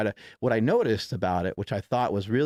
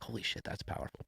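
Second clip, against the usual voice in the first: 1 hushed awe; 14 dB quieter than usual. Retold or imitated. Awed inner thought retold.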